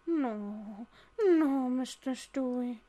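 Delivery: put-on slow, drawn-out elderly maid refusing; drawn-out old-lady voice, trailing softly; old woman impression. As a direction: stretch each word like an old cleaning lady. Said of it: "The old woman".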